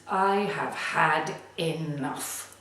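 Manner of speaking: "I have had enough." slow, every word stressed; slow and firm: "I have had enough."; emphasized, determined. Shown as a demonstration. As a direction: lay down each word slowly, done with it. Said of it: "very emphasized words, very clear".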